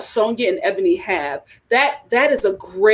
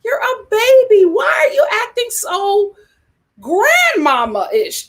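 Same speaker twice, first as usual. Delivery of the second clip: much higher, louder disbelieving cry; shocked outcry; 11 semitones higher, 4 dB louder than usual. Real, not acted. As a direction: wail it out in disbelief. Shocked at her age, crying out.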